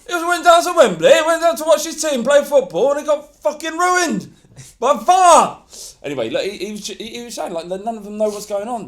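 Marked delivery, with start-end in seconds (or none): 0.1-5.6 put-on much higher and louder Rochdale accent, ranting. Mimicking a Rochdale fan on the radio, ranting loud and high in a Rochdale accent. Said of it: "Do the accent".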